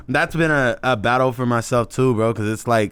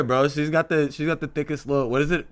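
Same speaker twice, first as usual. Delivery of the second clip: ordinary delivery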